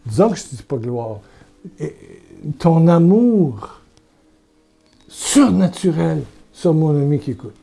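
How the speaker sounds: intense, loud prayer over a friend; fervent prayer. Real, not acted. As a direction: pray with fervor, rising.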